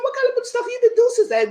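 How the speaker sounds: high mocking question; mock challenge. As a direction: challenge him in a high put-on voice. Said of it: "I said".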